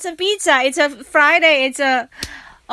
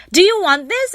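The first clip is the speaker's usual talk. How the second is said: eager offer; 8 semitones higher, 5 dB louder than usual. Retold or imitated. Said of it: "She's like".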